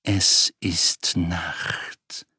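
slow, low menacing growl. Acted. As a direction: growl it slowly like a wolf. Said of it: "grollte sie".

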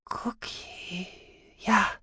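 faint, high whisper; frail, airy recognition; breathed. Acted. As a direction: breathe it out weakly. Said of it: "hauchte Sahira".

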